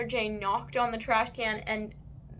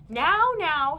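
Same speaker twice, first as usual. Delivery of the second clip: soothing moms; 6 semitones higher, 8 dB louder than usual. A narrator's reading. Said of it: "said their moms".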